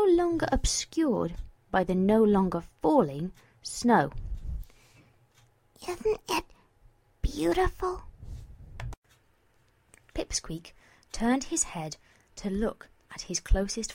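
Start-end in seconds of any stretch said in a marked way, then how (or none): 5.9-8.0 put-on high, gentle girlish voice; wistful wonder. Mare lying beside her friend under stars, wondering. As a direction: marvel in a high, dreamy tone. Gazing at the night sky.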